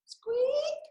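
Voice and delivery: very high whispered squeaks; high, whispered mouse squeak; tiny squeak. Acted.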